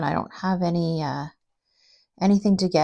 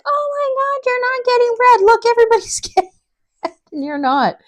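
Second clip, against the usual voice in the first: very high, loud mock outcry; over-the-top high-pitched exclaiming; loud woman imitation; 6 dB louder than usual. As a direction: squeal it like a loud, astonished stranger. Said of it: "she was already loud".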